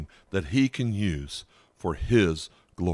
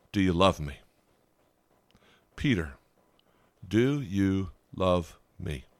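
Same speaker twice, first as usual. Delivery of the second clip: gentle Jesus; 2 semitones lower than usual. Retold or imitated. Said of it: "Jesus asked a marred, broken Peter three times".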